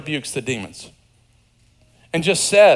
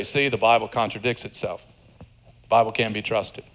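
mock sceptic; 6 semitones lower, 5 dB quieter than usual. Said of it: "some read these stories and they say".

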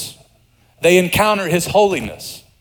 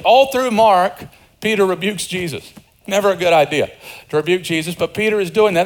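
usual speaking voice

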